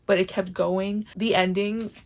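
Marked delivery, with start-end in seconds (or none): 1.2-1.9 near tears, voice wavering; holding back tears. Real, not acted. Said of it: "I'm trying so hard not to cry".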